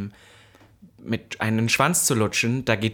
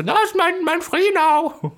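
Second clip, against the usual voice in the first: much higher, louder slurred mock cry, drawn out slowly; mock drunk shout; 5 dB louder, slower than usual. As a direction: play the drunk friend yelling for a taxi. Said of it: "Und dann schreist du noch so".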